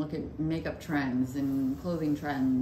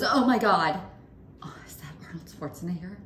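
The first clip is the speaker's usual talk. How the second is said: mom starstruck; 3 semitones higher than usual. Retold or imitated. Mother spotting her crush at the restaurant, starstruck and suddenly hushed. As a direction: burst out, then whisper the star's name. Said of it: "and she goes".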